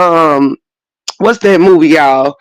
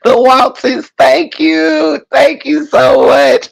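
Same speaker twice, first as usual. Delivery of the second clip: mock tearful thanks; 8 semitones higher, slower than usual. Mocking sentimental players with a fake teary thank you. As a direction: overplay a teary award speech. Mock sobbing gratitude speech.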